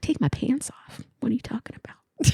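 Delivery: whispered disbelief, hushed and aghast; incredulous whisper to the receptionist; shocked whisper. Retold or imitated. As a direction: hiss it like you can't believe it. Retold shocked reply to receptionist.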